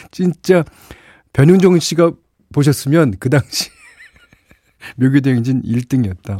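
high-pitched amused talk breaking into laughter; real laughing. Real, not acted. DJ tickled by stunt-level whistling, laughing as he talks. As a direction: talk through the giggles, starting high. Cracking up over the virtuoso whistling.

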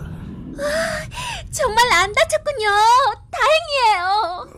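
high relieved exclamation; relieved cry. Acted. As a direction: gush with relief. Relieved he is unhurt.

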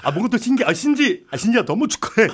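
high, excited, laughing celebrity voice; bubbly high-pitched star impression; Noh Hong-chul impression. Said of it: "노홍철 한번 가요".

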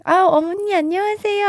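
살갑게 높여 부르는 인사; 다정하게, 조금 높게 인사하듯; friendly greeting. Pretend. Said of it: "살갑게 이렇게". (살가운 인사 흉내)

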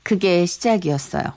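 reflective narration. A narrator's reading. Narrator looks back.

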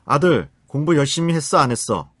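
acted stern parent checking up; pressing parental question. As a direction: grill your son, yes or no. Parent's closed, pressing question to son.

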